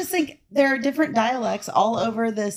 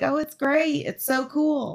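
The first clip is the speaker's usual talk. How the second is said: higher, lightly gushing mock praise; imitating locals' praise; 6 semitones higher, slower than usual. Quoting locals on New Orleans, imitating their praise. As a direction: mimic locals talking the place up. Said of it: "everybody's like".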